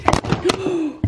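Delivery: sharp high gasp of shock; startled gasp. Real, not acted. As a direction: gasp as the camera falls. Camera tripod falls.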